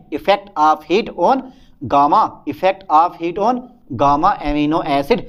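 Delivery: very slow, word-by-word heading; drawn-out dictation with gaps between phrases. Shown as a demonstration. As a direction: stretch each word out for note-takers. Teacher dictates heading while writing.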